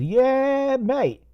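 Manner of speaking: high, stretched-out mock English voice; Creepy English. Creepy English pulling his station wagon over, high mock voice. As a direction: squeak it out as a caricature Englishman. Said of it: "and he says".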